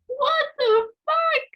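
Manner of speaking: very high, drawn-out mock shriek; loud cartoonish squeal of outrage; little ego shrieking. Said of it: "And my little ego".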